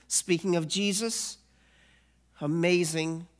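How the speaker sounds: ordinary delivery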